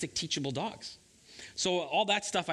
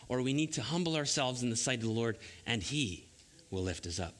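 normal talking voice

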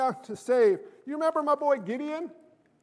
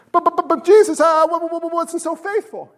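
high, loud stammered protest; flustered believer; 5 semitones higher, 6 dB louder than usual. Acted. Believer told well done, protesting in a flustered stammer. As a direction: stutter and trip over the confession.

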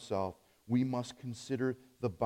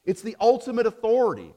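raised high and loud, ringing out; proclaiming reformers' cry; 8 semitones higher, 9 dB louder than usual. Retold or imitated. Preacher voicing the reformers' defiant cry, loud and high. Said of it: "They said".

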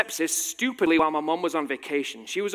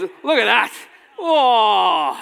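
doting mum coo; 6 semitones higher, 10 dB louder, much slower than usual. Retold or imitated. Mum cooing over her baby.

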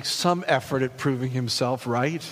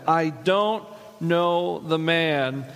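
slow, drawn-out, louder and higher oath; swearing denial; 4 semitones higher, 3 dB louder, much slower than usual. Retold or imitated. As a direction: Peter swears to the crowd, loud and slow. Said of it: "he swore to them".

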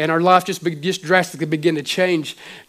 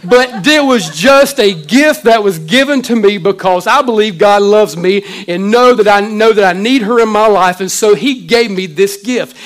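passionate preaching; 3 semitones higher, 7 dB louder than usual. Real, not acted. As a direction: preach it out to the congregation with full heart. Pastor preaching with music under.

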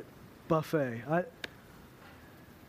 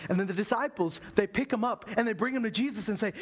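ordinary delivery

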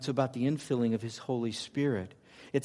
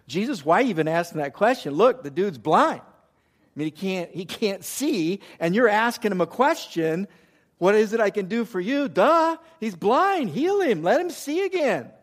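high, loud exasperated disbelief; mock the disciples' impatient duh; incredulous disciples; 7 semitones higher, 6 dB louder than usual. Disciples scoffing at Jesus, loud high exasperation. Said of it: "I'm wondering if the disciples thought this".

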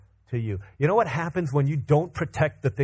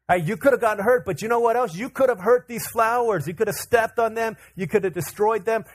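put-on higher-pitched, urgent lecture to a child; pitched-up, exasperated dad lecture; earnest parental warning; 7 semitones higher than usual. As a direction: list the dangers to a toddler. Retold scolding of his toddler.

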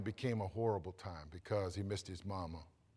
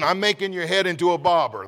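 ordinary delivery

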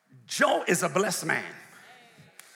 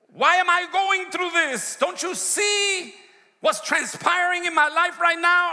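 high, loud complaining plea to God; a believer wailing at God, high and loud; whining desperate prayer; 10 semitones higher, 6 dB louder than usual. Acted. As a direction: cry out like someone sure God has left them. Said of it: "the kind of prayer that we sometimes pray".

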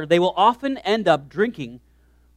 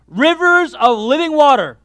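1 preaching emphasis; 6 semitones higher, 6 dB louder than usual. Acted. Preaching climax on scripture.

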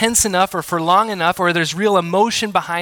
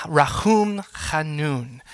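Rahum Chanun spoken very slowly, each syllable weighted; solemn, slow; much slower than usual. Acted. Solemn Hebrew title of God.

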